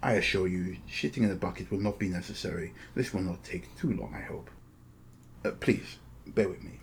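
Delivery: softly spoken formal host, measured and discreet; courteous, secretive agent. Acted. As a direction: speak softly as a polite go-between. Game master voices Face Dancer.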